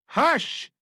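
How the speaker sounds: a hint of a commanding lady; silver voice with steel. A narrator's reading. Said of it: "her voice of silver that still had in it something of steel".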